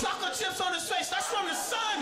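high, exasperated comic insistence; hammed-up high-pitched protest; outraged rant. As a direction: drive the point home in mock outrage.

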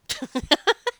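high, loud burst of laughter. Real, not acted. Amused at her own accidental accent, a high loud laugh. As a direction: crack up at your own silliness.

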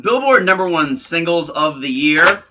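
ordinary delivery

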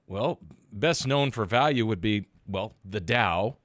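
ordinary talk

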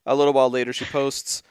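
ordinary talk, nothing marked

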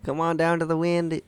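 put-on a botched Chicago accent that slips away. Friend tries a Chicago accent on request, botched accent. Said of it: "your best, uh, Chicago accent".

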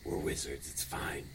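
whispering. Acted. Hushed reassurance among party.

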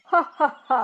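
loud mock laugh; big booming ha ha ha; lion laughing. Lion laughs at the mouse, loud mocking laughter. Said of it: "The lion laughed".

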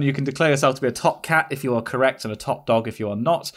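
ordinary talk, nothing marked

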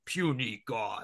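lightly voiced Hulk impression. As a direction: put on a hint of a dismissive brute. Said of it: "Hulk just repeatedly smashes him into the ground and then goes".

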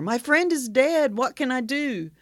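high, loud, drawn-out cry for help; panicked caller. Acted. Hunter phoning the emergency operator, mock panic. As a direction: plead in hammed-up panic.

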